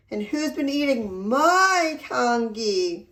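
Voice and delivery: a hint of an upset mother; slow mild plaintive wife voice; lightly voiced Missus Chan. A narrator's reading. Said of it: "cried Missus Chan".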